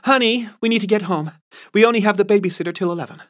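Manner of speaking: a pretend wife pulling him away; Carla playing wife. Carla bails Ryan out.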